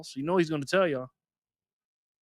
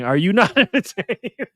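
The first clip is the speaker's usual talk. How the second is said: slow, higher mock arena shout breaking into a laugh; gladiator's "not entertained" yell; 6 semitones higher, 5 dB louder than usual. Streamer imagining a viewer yelling the gladiator line, mock shout. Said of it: "Waiting for Jerry to now stand up yelling".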